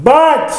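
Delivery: "but" popped out high and sudden; emphatic "but". Retold or imitated. Deacons about to contradict their praise, emphatic high "but". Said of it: "fixing to contradict myself".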